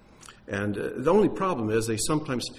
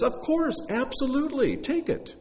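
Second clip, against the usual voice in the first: very high, agreeable seller's voice; high-pitched, hammed-up yes to a customer; eager donkey seller; 13 semitones higher than usual. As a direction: act out an over-willing seller, pitched way up. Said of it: "the man selling the donkey says".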